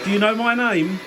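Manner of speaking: calling out. Real, not acted. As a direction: call out to an unseen spirit. Calling out to spirits.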